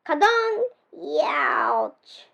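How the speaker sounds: comic bonk then pained youch; a cartoon bump; crash and ouch. A narrator's reading. Pete crashes into the chest, comic ouch.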